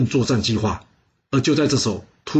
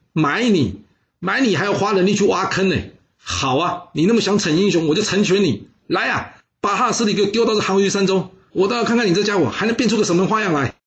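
higher, sneering royal taunt ending in a laugh; scornful mockery; 7 semitones higher than usual. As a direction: taunt him before his death. King mocks the condemned man. (轻蔑嘲讽)

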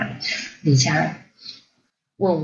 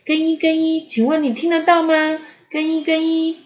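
high, even radio hail; calling by radio; 9 semitones higher than usual. A narrator's reading. Lisa radioing her husband, calling out. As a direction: call out high into a walkie-talkie. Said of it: "她用无线电对讲机呼叫更衣".

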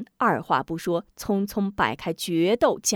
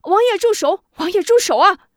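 much higher, loud pleading cry; desperate shout; 11 semitones higher, 8 dB louder than usual. Acted. Wu Zhong parrying the prince, shouting high and loud. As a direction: yell while fending off blows. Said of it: "一边招架一边喊".

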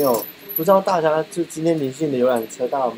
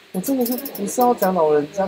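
ordinary talk

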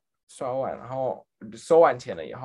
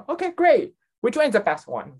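imitated enthusiasm, jumping higher on "great"; eager customer; 8 semitones higher, 4 dB louder than usual. Customer shown the shelf asks for advice, eager. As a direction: chirp it like a keen shopper.

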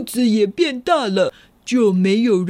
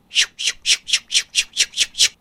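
whooshing sound effect; much faster than usual. Acted. Wolf changing clothes.

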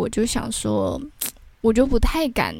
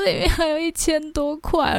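higher, tearful voice; crying; 6 semitones higher than usual. Retold or imitated. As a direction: replay the tearful answer to mom. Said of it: "我就一直哭".

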